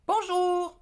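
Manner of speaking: "bonjour" stretched slowly and sung out high; drawn-out bonjour. Shown as a demonstration. Teaching viewers politeness, a slow sung-out "bonjour". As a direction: draw out "bonjour" as a pointed greeting. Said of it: "ni bonjour".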